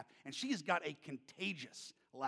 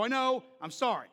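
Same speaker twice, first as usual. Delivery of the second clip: louder, higher sheepish sorry; loud apology; 6 semitones higher, 7 dB louder than usual. Retold or imitated. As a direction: apologize too loudly. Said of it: "And I go".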